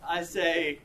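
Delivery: ordinary delivery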